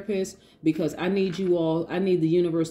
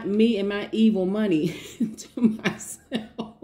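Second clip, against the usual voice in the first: laughing; 3 semitones higher, slower than usual. Real, not acted. Jokes about evil money.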